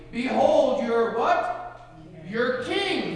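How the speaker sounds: loud, high mocking proclamation; jeering, booming announcement. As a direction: draw it out loudly in mockery. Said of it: "he said mockingly".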